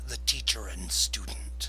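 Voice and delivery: unvoiced sinister whisper; whispered menace. Velandar reminding his student of his place, whispering. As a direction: drop to a threatening whisper. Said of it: "Velandar says".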